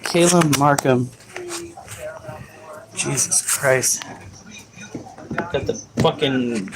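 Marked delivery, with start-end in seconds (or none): 2.9-6.7 low, soft swearing to oneself; self-directed grumble. Real, not acted. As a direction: grumble under your breath at your own forgetfulness. Grumbling at own bad memory.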